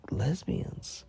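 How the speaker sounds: breathless whisper on one word. Real, not acted. Whispering the shocking word to viewers. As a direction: hush it like a secret.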